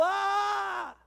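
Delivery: high, loud mock groan of a weeping man; wailing groan. Preacher imitating a man groaning at the altar, mock wail.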